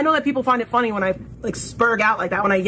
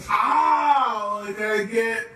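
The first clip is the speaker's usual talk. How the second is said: ordinary delivery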